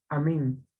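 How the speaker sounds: Amén, low with the E stressed; stress on last syllable. Shown as a demonstration. Teacher confirming the tilde, stress on MÉN in Amén. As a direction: put the weight on the E of Amén. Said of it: "fuerza de voz está en la E".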